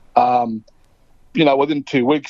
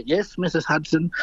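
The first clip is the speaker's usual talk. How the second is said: soft, slightly raised, meek imitation of an elder; Marlon Brando; 3 semitones higher, 5 dB quieter than usual. Brando answering the storyteller's mum about his age, meek imitation. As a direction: lightly imitate a humbled old man, hushed. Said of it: "He goes".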